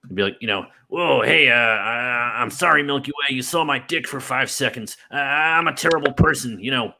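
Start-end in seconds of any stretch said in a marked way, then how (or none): 0.9-6.6 put-on loud, high slurred drunk rant; mock drunk cartoon scientist; drunk Rick rant. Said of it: "a drunk Rick rant".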